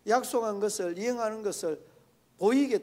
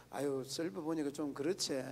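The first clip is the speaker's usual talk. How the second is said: mild agreeable reply; 4 semitones lower, 7 dB quieter than usual. Retold or imitated. Modelling a mild acceptance.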